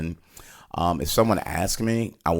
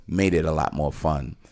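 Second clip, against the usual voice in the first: ordinary delivery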